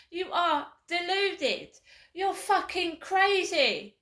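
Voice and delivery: loud and high, slowing to the end; angry reply. Real, not acted. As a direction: shout it back, furious. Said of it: "You turn round".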